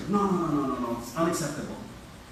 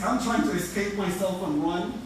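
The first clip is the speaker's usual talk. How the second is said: usual speaking voice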